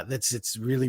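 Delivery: usual speaking voice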